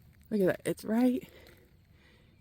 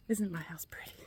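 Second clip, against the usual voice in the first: breathless whisper; 3 semitones lower, 11 dB quieter than usual. Real, not acted. Winded while walking uphill.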